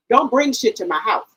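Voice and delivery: normal talking voice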